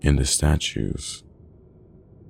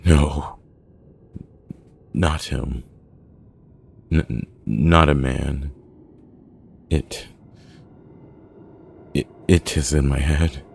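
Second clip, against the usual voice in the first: halting, stammered panic; frantic panic; 2 semitones higher, 4 dB louder than usual. A narrator's reading. Milo insisting it is in his head, panicking. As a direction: stumble and insist, mildly panicked. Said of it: "Milo's still frantically panicking".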